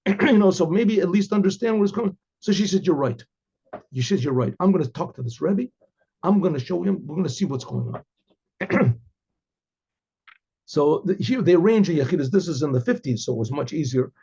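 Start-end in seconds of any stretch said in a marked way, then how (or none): none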